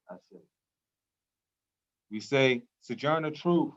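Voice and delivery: solemn call-and-response libation naming the ancestors. Acted. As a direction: call each name out like an invocation, pausing for the response.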